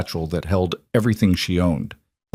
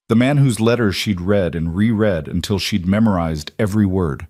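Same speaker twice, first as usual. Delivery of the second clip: ordinary delivery, nothing marked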